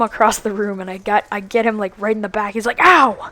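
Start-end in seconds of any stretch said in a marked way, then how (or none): 2.8-3.3 high, loud mock yelp; imitated pain cry. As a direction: mimic a friend hit in the back. Retold friend hit in back.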